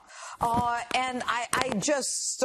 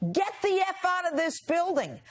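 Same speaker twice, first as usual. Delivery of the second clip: imitated yell; 7 semitones higher than usual. Guest retelling being thrown out of the building, mock yell. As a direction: imitate someone yelling, pitched up without full volume. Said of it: "she's yelling at me".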